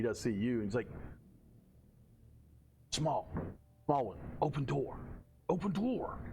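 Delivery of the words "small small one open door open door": whisper yelling. Acted. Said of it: "whisper yelling".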